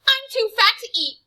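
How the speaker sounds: high, loud panicky bargaining; boy bargaining with the vampire; frightened pleading. Acted. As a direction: talk your way out in terror. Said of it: "he wasn't simply begging".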